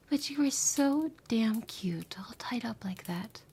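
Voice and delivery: playful, hushed tease; teasing whisper. Acted. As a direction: whisper it with a sly grin.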